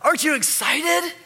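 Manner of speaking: high, excited news-bringing; upbeat servant. Acted. Servant telling the brother of the celebration, very upbeat. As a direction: burst out with it, high and eager. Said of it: "he had a very up kind of".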